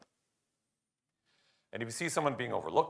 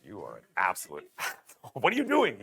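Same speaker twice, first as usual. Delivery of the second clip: mock scolding; 4 dB louder than usual. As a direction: play at chewing out a colleague. Acted dressing-down of a colleague in private.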